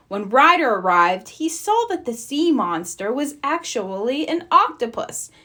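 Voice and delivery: usual speaking voice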